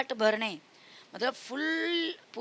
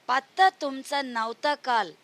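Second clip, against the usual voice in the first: put-on sing-song, louder recital of a children's poem; poem recitation; 3 semitones higher, 5 dB louder than usual. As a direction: recite the verse loudly for kids. (बच्चों को कविता सुनाते हुए, ज़ोर से गाकर पढ़ना)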